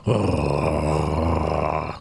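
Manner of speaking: long low rattling growl; inhaled dog snarl. Shown as a demonstration. Streamer demonstrating favorite dog noise to viewers, low inhaled snarl. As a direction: mimic a snarling dog breathing in. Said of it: "breathe in when they're snarling".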